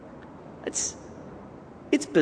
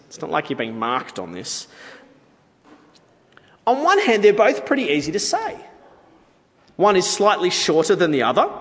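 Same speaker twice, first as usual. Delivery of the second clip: usual speaking voice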